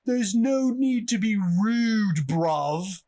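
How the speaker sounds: put-on loud, high, slow London accent yelling; hammed-up British yell of no need to be rude; mock tough British accent. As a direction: imitate a belligerent Londoner with a thick British accent. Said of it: "so it's very thick British accent".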